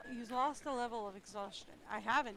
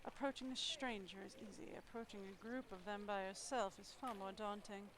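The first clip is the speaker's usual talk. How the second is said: ordinary talk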